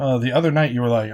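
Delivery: normal talking voice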